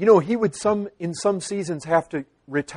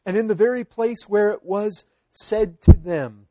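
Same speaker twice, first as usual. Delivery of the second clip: usual speaking voice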